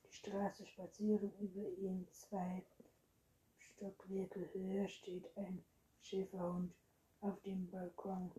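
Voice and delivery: ordinary delivery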